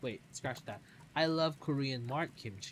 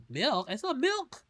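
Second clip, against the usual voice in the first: excited surprise; 11 semitones higher, 8 dB louder than usual. Real, not acted. Spots milk in the game.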